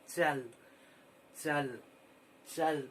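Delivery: चल said loud and slow, three times; slow repeated word. Shown as a demonstration. Teacher repeating चल slowly so children find the letters. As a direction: sound out चल carefully, one at a time. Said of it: "धीरे से आराम से तीन चार बार बोले".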